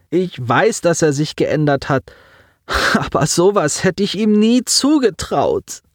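put-on high, strained voice of a grieving boy; a young man fighting back tears; lightly voiced teen on the edge of crying; grieving boy Simon. A narrator's reading.

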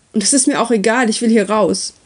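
restrained narrated outburst; mildly agitated, wanting out; desperate shout. A narrator's reading. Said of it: "schrie Uri". (verhalten verzweifelter Ausbruch)

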